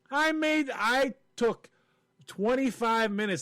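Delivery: high-pitched exasperation; worked-up comic indignation; indignant protest. Real, not acted. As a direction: protest your wasted effort, voice high. Indignant about wasted effort.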